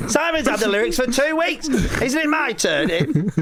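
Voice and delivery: put-on high, whiny kid begging for a turn; pleading kid. Child begging a sibling for the lyrics, high and whiny. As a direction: beg like a child wanting the lyrics back.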